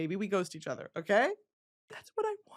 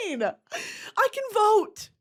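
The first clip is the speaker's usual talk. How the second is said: ordinary delivery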